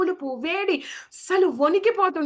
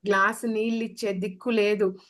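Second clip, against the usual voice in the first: ordinary talk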